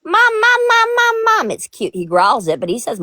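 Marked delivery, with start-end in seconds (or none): 0.0-1.5 high, loud whining mom calls; high whiny dog voice. Retold or imitated. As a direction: whine it like a needy puppy. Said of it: "He goes".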